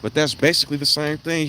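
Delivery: usual speaking voice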